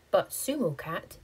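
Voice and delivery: usual speaking voice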